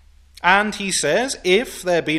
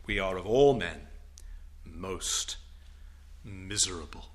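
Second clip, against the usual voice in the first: solemn quote; 8 semitones lower, 8 dB quieter than usual. Retold or imitated. Preacher quoting Paul, hushed and heavy. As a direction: let the apostle's despair sink low and soft. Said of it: "then, he says".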